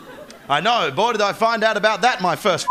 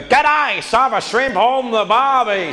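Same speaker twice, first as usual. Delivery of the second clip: hammed-up imitation of English people doing Australian; mock Australian accent; 3 semitones higher, slower than usual. Mocking the audience's attempt, broad fake Australian accent. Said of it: "when you guys go".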